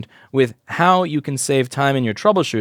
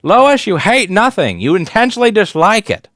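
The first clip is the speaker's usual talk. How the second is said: put-on southern accent; 9 semitones higher, 7 dB louder than usual. Old boss correcting the young Loish, loud southern accent. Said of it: "this southern accent".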